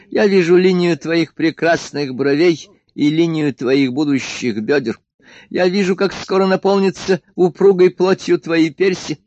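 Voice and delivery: hammed-up grandiose flattery; pompous emperor. A narrator's reading. Emperor flatters the girl, pompous and lofty.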